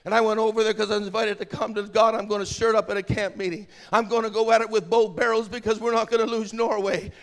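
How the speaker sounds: loud, driving revival preaching; loud, urgent pulpit delivery; full-force preaching. Real, not acted. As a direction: preach it hard over a crowd.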